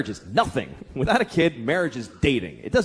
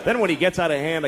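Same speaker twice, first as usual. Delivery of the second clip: normal talking voice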